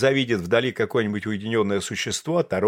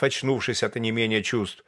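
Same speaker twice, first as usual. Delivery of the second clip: ordinary delivery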